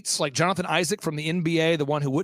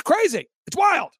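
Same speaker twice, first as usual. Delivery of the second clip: high, louder burst on wild; amazed exclamation; 10 semitones higher, 4 dB louder than usual. Real, not acted. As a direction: blurt it out in amazement. Marvelling at own event's lineup.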